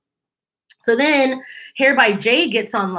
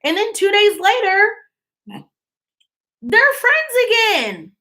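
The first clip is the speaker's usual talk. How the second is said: sarcastic disbelief; 11 semitones higher than usual. Real, not acted. Sarcastic about reconciliation.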